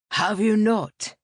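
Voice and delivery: lightly voiced priest, drawn out; Father Thames. A narrator's reading. Priest answering a parishioner after the service, slow and emphatic.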